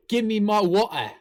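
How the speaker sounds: hammed-up British demand; mock British accent. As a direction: put on British glottal stops. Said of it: "that's totally British".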